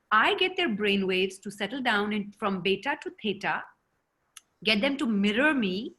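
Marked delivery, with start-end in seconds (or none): none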